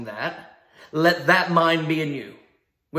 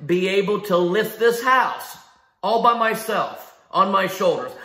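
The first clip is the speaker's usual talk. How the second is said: usual speaking voice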